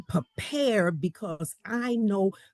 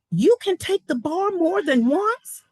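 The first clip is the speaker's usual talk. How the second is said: high, louder mock astonishment; incredulous comeback; 8 semitones higher, 5 dB louder than usual. Young attorney answering her challenger, mock incredulous. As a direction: act amazed, pitched well up.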